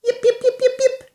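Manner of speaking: very high yips; a little dachshund yipping; tiny dog yipping. Acted. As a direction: yip like a sausage dog. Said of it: "said Schnitzel von Krumm".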